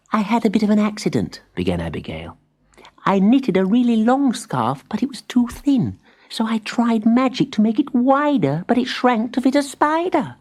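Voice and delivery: high, quick little confession; sheepish spider. A narrator's reading. Abigail explains the shrunken scarf in a high hurried voice. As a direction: hurry through the excuse.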